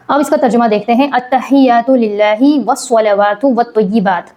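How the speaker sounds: usual speaking voice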